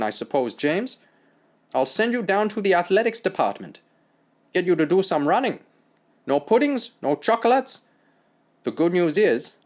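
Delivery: German doctor scornful lecture; German accent. Acted.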